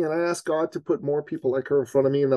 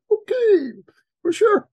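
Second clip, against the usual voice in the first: taken aback; 6 dB louder, slower than usual. Retold or imitated. Taken aback by her eagerness.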